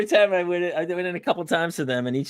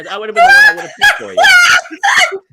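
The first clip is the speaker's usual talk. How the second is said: laughing shout; 12 dB louder than usual. Real, not acted.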